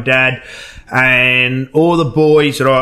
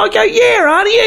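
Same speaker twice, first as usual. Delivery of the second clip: high, put-on caller voice; prank call voice. Prank caller greeting Auntie Joyce, high put-on voice. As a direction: fake a relative on the phone.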